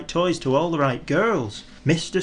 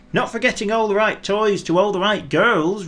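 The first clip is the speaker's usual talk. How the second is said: higher snowman, playful correction; cheeky snowman; 9 semitones higher, 4 dB louder than usual. A narrator's reading. Snowman adding to Father Christmas's thanks, playful. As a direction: chirp the addition, higher. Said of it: "replied Mister Snow".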